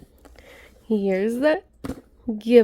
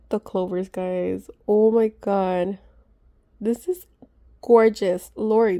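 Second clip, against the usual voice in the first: ordinary delivery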